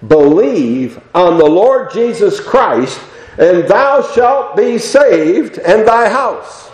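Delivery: slow, ringing scriptural command; imperative. Acted. Apostles answering the jailer's question, solemn imperative. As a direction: declare each phrase deliberately, lifting the pitch toward the end. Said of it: "here's the imperative".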